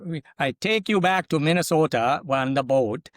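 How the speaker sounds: playful Minnesota accent, long boat. Shown as a demonstration. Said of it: "your Minnesota accent and say boat as long as you can".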